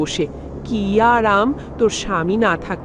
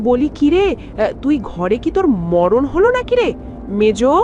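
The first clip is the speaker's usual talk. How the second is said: scolding shout; 5 semitones higher than usual. Acted. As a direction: shout at her through the door. Scolding call to daughter-in-law.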